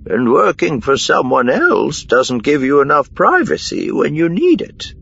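high female character voice, eager; Miranda reasoning keenly. A narrator's reading. Miranda adds to Korn's deductions, high and keen. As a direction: reason it out, pitched well up.

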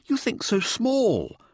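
high, slow, drawn-out disappointment; slow deflated sigh in a high voice. A narrator's reading. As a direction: draw it out high, as if let down. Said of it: "Mirza sighed as if deflated".

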